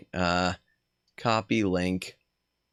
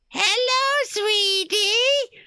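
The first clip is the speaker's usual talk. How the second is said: put-on much higher sugary old-lady voice; granny witch voice; much slower than usual. Streamer voicing the granny witch greeting the player. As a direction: coo it like a sweet granny witch.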